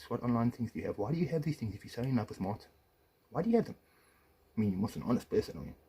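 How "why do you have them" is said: high, sharp demand; angry outburst. Real, not acted. Husband confronting his ex-wife, sharp high demand. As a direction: voice jumps up in outrage.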